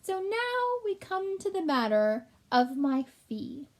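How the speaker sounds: high, slow, greedy rat; sly rat voice. Acted. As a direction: slowly set up the price. Gilbert sly.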